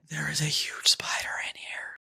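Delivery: hushed whisper, no voice at all; whispering the warning to his wife. Retold or imitated. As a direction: breathe it out so she won't panic. Trying to stay calm, avoid scream.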